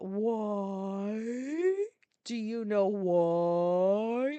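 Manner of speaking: very slow, over-stretched questioning; rude outburst. Retold or imitated. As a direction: drag "why" out slowly, rising at the end. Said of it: "say it like that".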